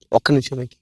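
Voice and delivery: low, soft stalling murmur; distracted hushing. Neighbour uncle putting off Venky, low and hushed. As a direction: brush him off under your breath.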